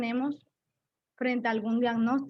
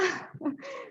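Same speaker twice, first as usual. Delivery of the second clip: bright laugh; 7 semitones higher, 6 dB quieter than usual. Real, not acted. Laughs after the son's reply.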